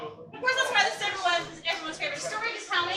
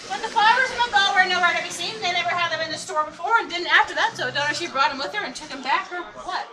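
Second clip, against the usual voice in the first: tour guide narration; 3 semitones higher, 6 dB louder than usual. Acted. Ghost tour guide presenting.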